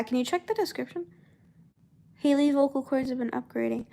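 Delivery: ordinary talk, nothing marked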